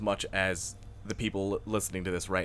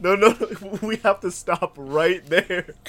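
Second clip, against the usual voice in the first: higher, laughing plea to stop; laughing protest; 7 semitones higher, 4 dB louder, slower than usual. Real, not acted. Cohost laughing, begging to stop the story. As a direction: beg to stop through laughter.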